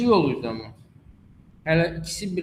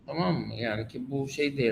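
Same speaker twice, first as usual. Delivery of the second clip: ordinary talk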